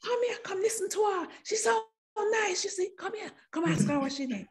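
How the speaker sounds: high, fast patois calling friends over; excited Jamaican kids. Retold or imitated. Schoolkids calling friends to hear the new girl, excited. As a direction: call the others over eagerly. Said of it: "the Jamaican kids were like".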